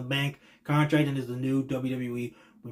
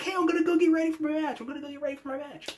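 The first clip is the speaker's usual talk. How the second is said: mock Sami voice; 13 semitones higher, faster than usual. Imitating Sami leaving to prepare.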